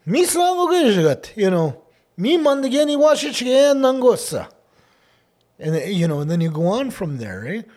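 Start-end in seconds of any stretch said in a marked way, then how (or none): 0.1-1.4 high, loud opening proclamation; attention-getting declaration. Shown as a demonstration. Speaker shows how to get somebody's attention, loud high declaration. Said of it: "if you come out there and". 2.2-4.5 loud, high-pitched oratory; attention-getting declaration. Shown as a demonstration. Speaker shows how to get somebody's attention, loud high declaration. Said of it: "you've got the attention of".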